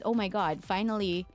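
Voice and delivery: ordinary talk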